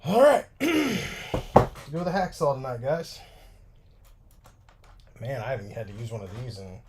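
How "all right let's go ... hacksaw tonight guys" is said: louder, upbeat call to viewers; excited announcement. Real, not acted. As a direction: announce it with energy. Switching tools, announcing.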